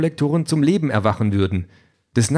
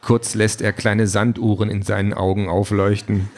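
ordinary delivery, nothing marked

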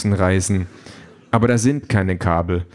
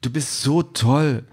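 slow, higher-pitched fawning digital friend; drawn-out, higher flattery; digital boyfriend; 5 semitones higher, slower than usual. A narrator's reading. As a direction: gush slowly as the simulated boyfriend. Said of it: "sagt Denise persönlicher digitaler Freund".

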